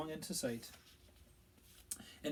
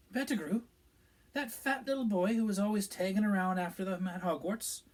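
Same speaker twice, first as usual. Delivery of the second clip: a higher, disbelieving barmaid voice; Madame Rosmerta; 7 semitones higher, 7 dB louder than usual. A narrator's reading. Barmaid gossiping with the Minister, shocked and disbelieving. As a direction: voice the landlady's shocked question.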